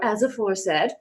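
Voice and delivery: ordinary delivery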